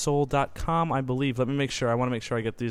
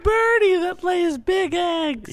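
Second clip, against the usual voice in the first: silly high voice; 4 dB louder, slower than usual. Pretend. Joking about kiwi birds.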